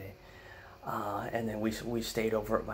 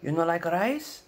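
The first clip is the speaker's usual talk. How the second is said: put-on high, loud, slow accented offer; Filipino grandma accent; 8 semitones higher, 6 dB louder than usual. Grandma offering rice to grandson, in a Filipino accent. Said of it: "she goes".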